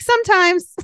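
high, curt one-word reply; mimicking curt answer. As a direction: toss off a curt "Sometimes.". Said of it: "your answers would be like".

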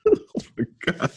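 high, cracking with laughter; laughing disbelief. Real, not acted. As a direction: gasp it out mid-laugh. Breaks up at the answer.

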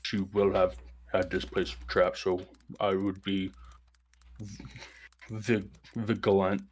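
lightly voiced ancient tree, hesitant; a hint of a giant tree, unsure and wary; tree character voice.

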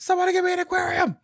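high, loud, fast demanding shout; mock yell. Imitating her calling out to the guys, yelling. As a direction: holler it out like a sudden order. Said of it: "just yells it out".

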